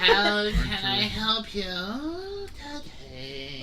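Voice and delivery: put-on slow, drawn-out bored Valley Girl; tired Valley Girl. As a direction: drag it out like a weary shop girl. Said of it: "Sounds like a tired Valley Girl".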